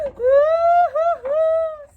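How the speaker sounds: high shouted mock wail; loud crying. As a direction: wail out across the pond. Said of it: "He cried louder and louder".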